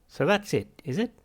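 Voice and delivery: high, loud bubbly machine voice; burbling machine. Acted. Machine answering Arthur, cheerful burbling.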